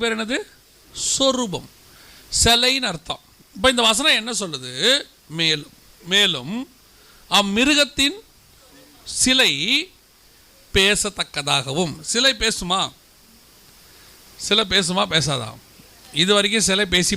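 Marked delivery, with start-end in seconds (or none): 5.4-11.9 slow, deliberate verse quote; slow quoting. Acted. Preacher re-reads the verse with சிலை for the congregation. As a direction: spell out the verse word by word.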